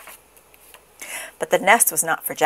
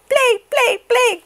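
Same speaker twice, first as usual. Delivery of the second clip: very high, eager gosling; excited baby goose; 4 dB louder, slower than usual. A narrator's reading. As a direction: clamour high to play. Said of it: "cried the excited baby goose".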